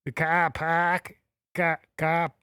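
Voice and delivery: drawn-out Boston "car park" with no R's; amateur imitation of a Bostonian; attempted Boston accent. Shown as a demonstration. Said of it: "Does that sound like Boston?".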